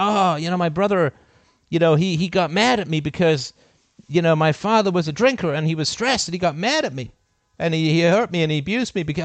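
high, loud mock whine of someone blaming their family; mocking whiny excuse-maker. Mimicking people who blame abusive family, mock whine. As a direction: parody the self-pitying excuse. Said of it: "people say this all the time".

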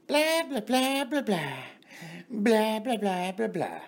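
high mocking blah-blah, falling away; mock chatter. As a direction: babble on like a nonstop talker. Mimicking a chattering woman.

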